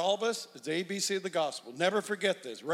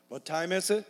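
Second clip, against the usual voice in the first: usual speaking voice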